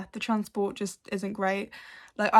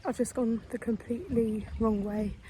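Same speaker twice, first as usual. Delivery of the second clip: ordinary delivery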